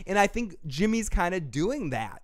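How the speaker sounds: ordinary talk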